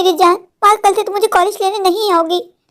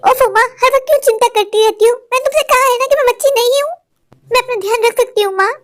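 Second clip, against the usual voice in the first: higher, fed-up complaint; higher, exasperated daughter brushing off her worried mother; exasperated whine; 4 semitones higher than usual. Acted. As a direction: roll your eyes at her fussing.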